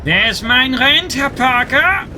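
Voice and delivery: put-on slow, high, thick German accent; angry landlord in a German accent. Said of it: "The thick German accent".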